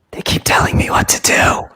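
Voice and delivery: breathy spooky whisper, the voices in his head; creepy whisper. Pretend. Host jokes about voices in his head, eerie whisper. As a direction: hush it like a secret the voices tell you.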